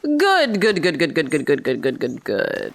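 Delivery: fading repeated good. Real, not acted. Player fading out a string of good after a tiny reward, falling away. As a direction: let the goods trail off to nothing.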